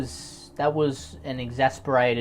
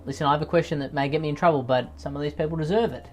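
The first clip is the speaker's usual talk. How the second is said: normal talking voice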